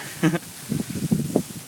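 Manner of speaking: short airy laugh in the cold; breathy laugh. Real, not acted. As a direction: laugh out at the wind. Laughing in the blizzard.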